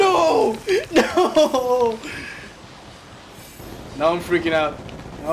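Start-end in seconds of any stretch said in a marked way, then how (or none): 0.0-2.0 high, wailing cry of defeat; anguished outcry. Real, not acted. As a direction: wail in dismay at losing. Gamer loses at last kill.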